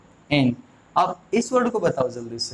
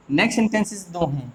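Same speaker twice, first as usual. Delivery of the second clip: ordinary delivery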